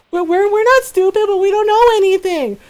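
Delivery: put-on high, pleading kid voices; whiny kids. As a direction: protest like a scared kid. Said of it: "they're just like".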